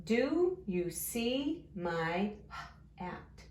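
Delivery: very slow, each word stretched; drawn-out early-reader pacing; word-by-word reading. Shown as a demonstration. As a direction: read along slowly, one word at a time. Sounding out a sentence word by word.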